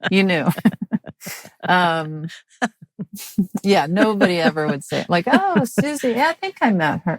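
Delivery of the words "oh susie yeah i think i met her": mock casual acquaintance.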